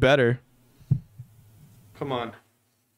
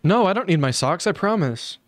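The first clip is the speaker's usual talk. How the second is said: higher, mock-eager put-on voice; mocking impression; 5 semitones higher than usual. Streamer mimicking the man in the video, mock-eager. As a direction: lightly parody an over-willing video guest.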